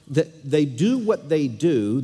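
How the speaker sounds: "they do what they do" slowed, "do" held heavy; slow, stressed do. Shown as a demonstration. Preacher to congregation, slow emphatic "do". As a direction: drag it out, landing on the final "do". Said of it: "That's actually what the Hebrew says".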